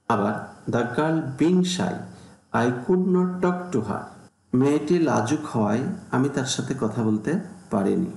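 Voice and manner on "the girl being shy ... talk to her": slow dictation pace; unhurried classroom dictation; slow example reading. Shown as a demonstration. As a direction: read it out carefully for students. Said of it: "teacher reads example sentence".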